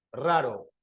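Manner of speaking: "Raro" with trilled first R, plain tapped second r; double R then single. Shown as a demonstration. Teacher contrasting the two R sounds in one word, trilled then normal. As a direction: roll the opening R of "Raro", tap the middle one. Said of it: "Acá normal".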